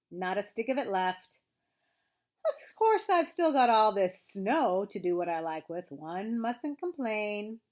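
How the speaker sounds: plaintive donkey grumble with drawn-out words; long-suffering, self-pitying grumble; melancholy Eeyore. A narrator's reading. Reads Eeyore's lines.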